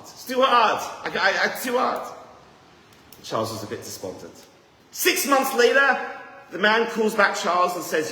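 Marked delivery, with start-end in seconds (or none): 0.0-2.1 loud, high wail of giving up; hammed-up loud despair; despairing cry. Man despairing on phone. 4.9-8.1 excited storyteller reveal; dramatic build-up. Real, not acted. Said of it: "storyteller building suspense".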